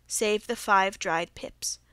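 usual speaking voice

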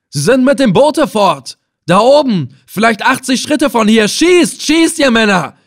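high, loud raging orders to fire; furious roar. Acted. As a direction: bellow at the men to shoot. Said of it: "brüllte er wütend". (wütend und laut befehlen, hoch)

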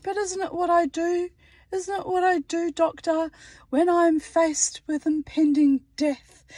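high-pitched retort to the doctor; mock protest. Retold protest to her doctor.

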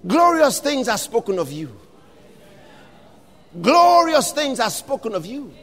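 ordinary delivery

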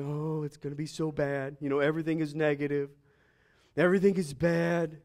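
lightly voiced Eeyore-like moaner; gloomy pessimist. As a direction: grumble it like a doom-laden worrier. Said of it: "what they would have sounded like".

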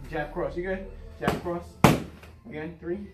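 normal talking voice

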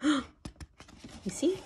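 hushed whisper to little listeners; storyteller's excited whisper. Acted. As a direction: lean in and whisper it. Hushed reveal to children.

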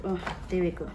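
ordinary talk, nothing marked

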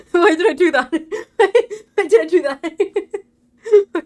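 high, loud words through laughter; laughing too much to speak cleanly; helpless laughter. Real, not acted. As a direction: wail the question while laughing hard. Laughing at own mistake.

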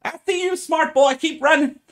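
high loud shouting woman; panicked halfling woman. Acted. Halfling woman yelling after the fleeing boy, high and loud. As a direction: yell after him, high and frantic.

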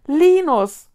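loud, high burst of realisation; sudden loud exclamation; blurted out. A narrator's reading. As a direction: shoot the name out suddenly. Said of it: "schoss es aus Konrad heraus".

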